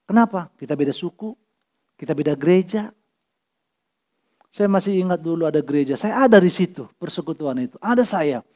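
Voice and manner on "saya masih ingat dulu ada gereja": usual speaking voice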